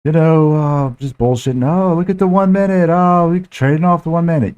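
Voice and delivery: high, mocking sing-song voice well above his own; mocking the other trader. Mimicking the chatty guy on the live call, high and mocking. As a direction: ham up a smug guy who won't stop talking. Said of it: "he's like".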